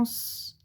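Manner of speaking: long sustained hiss for "S"; pure held sss on "S"; isolated S sound. Shown as a demonstration. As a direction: say "S" as a drawn-out sss, not the letter name. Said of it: "tu entends le son S".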